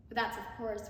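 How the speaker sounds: ordinary delivery, nothing marked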